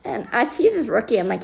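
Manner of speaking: ordinary talk, nothing marked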